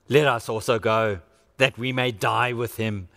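low, grim resolve of a doubter; Thomas resigned. Acted. Thomas telling the disciples to go die with Jesus, low and grim. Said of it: "said to the rest of the disciples".

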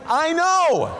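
high squeaky sheepish I know; mock sheepish admission. Answering the youth minister, high and sheepish. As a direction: own it in a silly high voice. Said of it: "I'm like".

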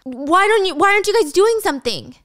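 high, loud exasperated whine; almost whining. Acted. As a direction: butt in with an urgent, pleading whine. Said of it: "butts in and almost whines".

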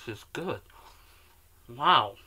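talking between bites of cookie; chewing while talking. Real, not acted. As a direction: mouth partly full of cookie. Seen 1: Bites cookie at -1s; jaw and cheeks seem to move early on.

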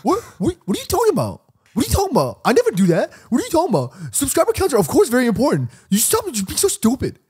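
very high, squawky mock scolding; exaggerated impression of an exasperated co-host; Jimmy. Podcaster doing an impression of his co-host, shrill mock outrage. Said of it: "Do your best Jimmy impression right now".

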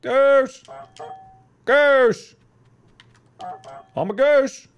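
high, loud honking cry; goose calling out. Pretend. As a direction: holler it like a goose.

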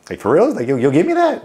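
high, loud disbelieving delight; amazed recruit. Retold or imitated. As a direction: gush like it's a fortune. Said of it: "I was like".

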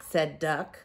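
plain reading voice

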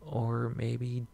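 ordinary delivery

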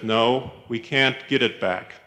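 ordinary delivery

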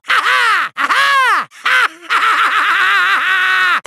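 high, maniacal ha-ha cackle; gloating villain laugh. Acted. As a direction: laugh in wild triumph. Villain gloating over his power.

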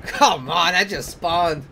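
normal talking voice

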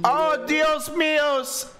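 slow, high, loud exclamation; mock dramatic cry. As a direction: stretch it out theatrically. Mocks teacher again.

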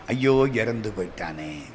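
low, soft acted dismay; hushed, sorrowful "alas"; mild lament. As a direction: grieve quietly over a death. Acted lament over dead cowherd.

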